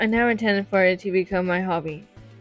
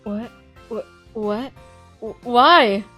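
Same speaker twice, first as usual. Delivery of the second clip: surprised stammer; 2 semitones higher, 6 dB louder, much slower than usual. Acted. Startled at notebook request.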